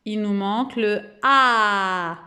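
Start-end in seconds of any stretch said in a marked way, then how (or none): 1.2-2.2 "a" held long and open; wide-open mouth. Shown as a demonstration. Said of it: "on ouvre grand la bouche". (« a » ouvert et prolongé)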